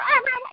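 high-pitched mimicked "ah, ah, ah"; knife-waving noise. Retelling a woman waving a carving knife, high imitated noise. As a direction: imitate someone wildly waving an electric knife. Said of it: "just waving it around like".

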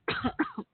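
sudden asthma cough; a short, harsh coughing fit. Real, not acted. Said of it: "Asthma cough again".

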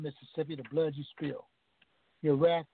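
ordinary talk

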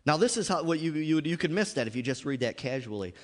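ordinary talk

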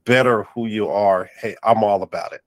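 ordinary delivery

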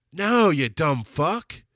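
high, loud, slow mock scolding; scolding oneself. Podcaster talking to themselves, mock scolding. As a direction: drag out a loud telling-off of yourself. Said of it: "Talking to myself".

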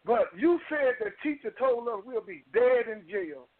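barely holding back tears. Real, not acted. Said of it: "I'm in tears".

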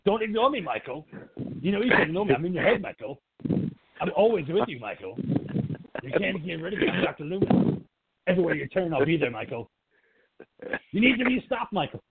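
high, insistent mock pleading; nagging Loomis. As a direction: pester him like a voice stuck in his head. Acted Loomis nagging Michael.

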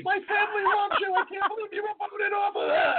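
high-pitched squealing woman; imitated screaming fan. Woman screaming to a TV chef from her car, imitated screaming. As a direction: shriek it like an excited fan. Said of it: "starts screaming out of a window".

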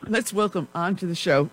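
usual speaking voice

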